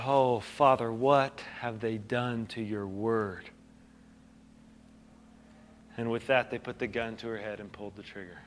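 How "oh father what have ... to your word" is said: soft, slow, lower, tender lament; gentle prayer. Retold or imitated. Kneeling teenage girl to God, soft gentle lament. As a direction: speak it gently as a grieving prayer. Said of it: "and just gently said".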